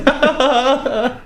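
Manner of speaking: gleeful giggle; giggling glee. Acted. Prance giggling to his friend about his trick.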